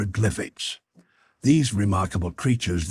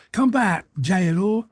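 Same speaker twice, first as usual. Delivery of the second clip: screamed; 11 semitones higher than usual. A narrator's reading. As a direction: scream it after him. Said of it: "screamed the Weru".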